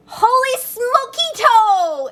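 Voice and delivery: high, loud, drawn-out astonished cry; exclaimed. Acted. Kitten startled by his own reflection, loud and amazed. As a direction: shout it out in shock at the mirror. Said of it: "exclaimed Skippy John Jones".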